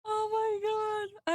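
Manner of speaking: high, slow, weak from laughing; drawn-out high wail after a laughing fit; crying with laughter. Real, not acted. Streamer recovering over old art, crying with laughter. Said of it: "I'm literally crying".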